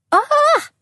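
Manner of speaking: very high, loud, sharp yelp; yelp of pain. Acted. Woman bitten on the hand yelps in pain. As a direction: cry out as your hand is bitten. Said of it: "I yelped".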